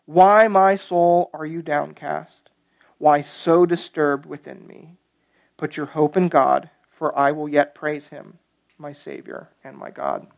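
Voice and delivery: slow, low psalm refrain fading away; subdued, prayerful close to the reading; hushed scripture reading. Acted. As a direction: let the refrain sink almost to a hush. Final refrain read softly.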